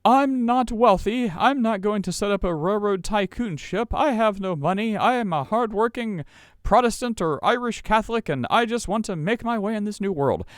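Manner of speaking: high, plaintive voice of a poor immigrant hoping to be let in; humble poor immigrant. Retold or imitated. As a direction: pitch it up as a modest hardworking immigrant pleading his case. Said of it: "people who are like".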